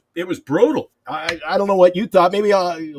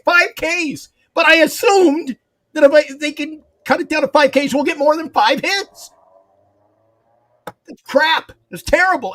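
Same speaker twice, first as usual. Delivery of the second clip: exasperated rant; 10 semitones higher than usual. Real, not acted. Fan ranting about team's poor hitting.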